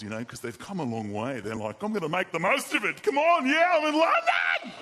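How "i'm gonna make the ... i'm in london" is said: high, loud Australian whooping shout; wild Aussie. Acted. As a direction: yell like an overexcited Aussie abroad. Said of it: "they're kinda wild".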